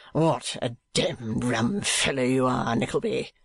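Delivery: slow, drawling fop; put-on dandy voice; mincing gentleman. A narrator's reading. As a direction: drawl it with affected familiarity.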